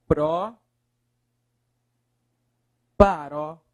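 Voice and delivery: "Pró, paroxítona" with long pauses between PRÓ, PARÓ and XÍTONA; isolated chunks of "Pró, paroxítona", widely spaced; broken into parts. Shown as a demonstration. Teacher builds the term in pieces.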